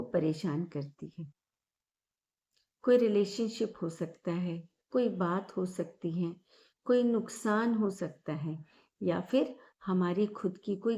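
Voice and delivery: calm, measured meditation guide with long pauses; guided meditation. Acted. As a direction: lead listeners gently through each breath, leaving space after every phrase. (शांत, ठहराव भरी ध्यान कराने वाली आवाज़)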